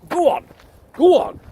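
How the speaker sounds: high, loud command to the dog; urging the dog. Real, not acted. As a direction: shoo him on firmly.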